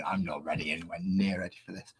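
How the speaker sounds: a quick whisper under the breath; whispered aside. Real, not acted. Streamer whispering to himself that he isn't ready. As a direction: let it slip out as a private whisper.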